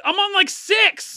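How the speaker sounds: indignant protest. Real, not acted. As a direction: squeak out the protest.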